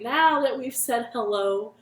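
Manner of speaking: ordinary delivery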